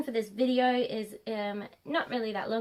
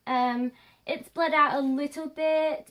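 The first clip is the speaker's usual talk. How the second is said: normal talking voice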